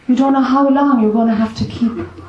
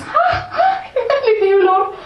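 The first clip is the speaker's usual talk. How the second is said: much higher, laughing through a mock cry; laughing mock wail; 13 semitones higher, slower than usual. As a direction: wail it high while cracking up. Old frog giving up, mock wail.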